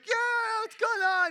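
put-on high, loud little-kid voice; imitating a small boy. A dad imitating his confused three-year-old son, high and loud. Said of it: "Benaiah's like".

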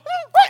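very loud high yell; shout at dog. Retold or imitated. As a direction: scream the dog away. Scaring the dog off.

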